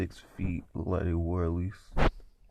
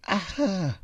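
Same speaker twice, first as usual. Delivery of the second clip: high-pitched imitated scream; short acted shriek; 13 semitones higher, 3 dB louder than usual. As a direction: cry out high like someone attacked. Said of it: "that's when I heard a scream".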